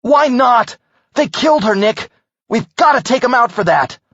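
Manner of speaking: loud high furious protest. Acted. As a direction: argue back in rage. Dean argues furiously for revenge.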